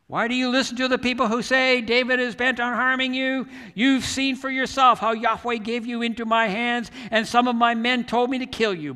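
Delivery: loud, high plea shouted across a valley; shouting. Retold or imitated. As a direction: call out your defence to a distant king. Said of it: "he started shouting to".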